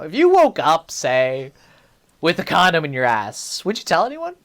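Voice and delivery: ordinary talk, nothing marked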